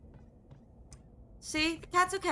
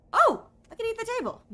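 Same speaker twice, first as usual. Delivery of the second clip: much higher, louder sudden cry; excited discovery; 11 semitones higher, 7 dB louder than usual. Real, not acted. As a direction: burst out in a high delighted yelp.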